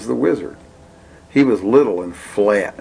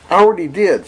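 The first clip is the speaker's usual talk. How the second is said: put-on child voice; 9 semitones higher, 4 dB louder, faster than usual. Billy answers proudly.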